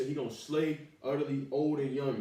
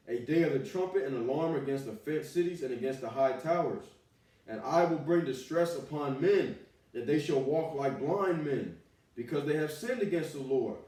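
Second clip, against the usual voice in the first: loud, raised preaching of the verses; fiery scripture reading; 5 dB louder than usual. Acted. Preacher thundering scripture at the sinners, loud and raised. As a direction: proclaim the prophecy like a street preacher.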